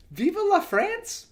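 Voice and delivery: high rising baffled question; puzzled. Real, not acted. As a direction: ask it like it makes no sense. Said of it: "didn't make sense".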